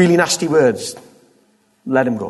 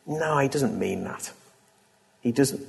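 ordinary talk, nothing marked

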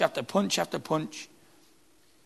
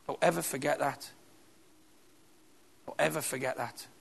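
much softer, earnest urging; soft earnest insistence; 2 semitones lower, 10 dB quieter than usual. Real, not acted. Preacher urging his congregation to remember their worth, soft. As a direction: press it home quietly, sincerely.

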